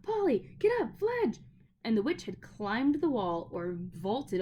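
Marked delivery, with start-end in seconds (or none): 0.0-1.4 high urgent shout to the others. A narrator's reading. Boy shouting to friends to flee the witch, urgent yell. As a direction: yell at them to get moving. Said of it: "was shouting".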